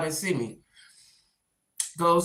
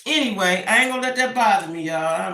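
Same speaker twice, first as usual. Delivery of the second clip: ordinary delivery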